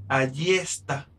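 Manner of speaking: ordinary talk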